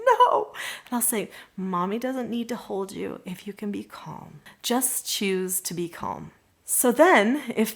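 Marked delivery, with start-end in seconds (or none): 0.1-0.5 put-on high, loud childish refusal; defiant toddler no. Toddler refusing mom's offer, a high shouted no. 1.6-6.3 soft soothing mom. Retold or imitated. Mother calming resisting toddler.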